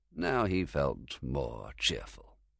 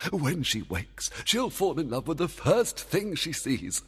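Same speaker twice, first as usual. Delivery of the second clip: fairy king voice; 9 semitones higher than usual. Acted. Oberon gloating, laugh.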